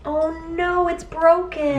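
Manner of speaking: high, drawn-out whine of disappointment; pouty high dismay. Real, not acted. Finds the gift vase broken.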